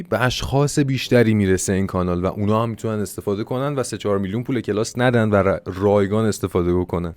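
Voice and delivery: ordinary talk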